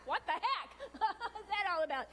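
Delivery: a real laugh breaking into the words; amused disbelief, laughing. Real, not acted. Laughing at husband's teasing call.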